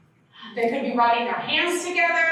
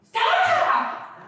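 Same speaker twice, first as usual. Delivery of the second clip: much higher shrill "Stop!"; mock yell; 3 dB louder than usual. Daughter to questioning parent, fed-up mock yell. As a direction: cut them off, fed up. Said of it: "she's just like".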